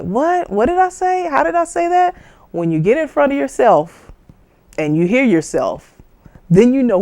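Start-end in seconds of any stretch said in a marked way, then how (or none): 0.0-2.1 much higher, startled questions; surprised at own voice. Retold or imitated. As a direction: hear yourself and be startled. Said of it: "will be like".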